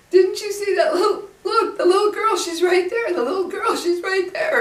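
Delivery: high, loud, panicked son voice; imitated freaking out. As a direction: act out a terrified child crying out to parents. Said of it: "he was freaking out".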